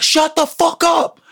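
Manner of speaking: high, drawn-out mock yell; slow, high-pitched retold scream; exasperated outburst. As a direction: snap after being ignored, pitch leaping up. Hushing escalates to an outburst.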